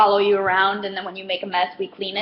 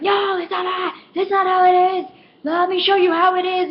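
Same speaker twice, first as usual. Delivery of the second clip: high, raised mock-indignant voice; pushy high-pitched insistence, getting louder; irate customer; 9 semitones higher, 4 dB louder than usual. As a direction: imitate a customer arguing back. Irate customer contradicting the cashier.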